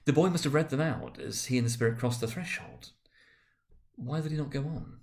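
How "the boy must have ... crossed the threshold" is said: ordinary delivery, nothing marked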